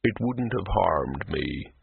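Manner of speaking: very slow, low, wounded troll; mildly aggrieved gentle troll; slow hurt troll. Acted. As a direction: speak with the weight of hurt pride.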